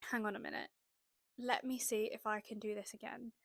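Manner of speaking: quietly plotting the next run; quiet thought. Retold or imitated. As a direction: hatch the idea under your breath. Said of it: "And then I thought".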